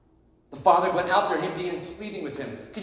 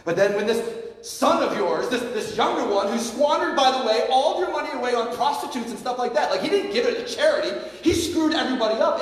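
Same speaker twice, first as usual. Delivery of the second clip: raised, pitched-up indignant complaint; older brother railing louder at his father; indignant rant; 8 semitones higher, 4 dB louder than usual. Retold or imitated. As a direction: build an outraged older brother's grievance. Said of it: "how the older brother speaks".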